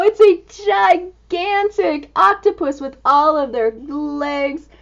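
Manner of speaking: usual speaking voice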